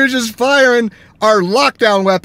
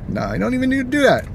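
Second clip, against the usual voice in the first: normal talking voice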